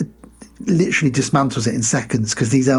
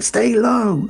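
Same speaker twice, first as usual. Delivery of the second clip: mock army order; 9 semitones higher than usual. Army guys calling out orders.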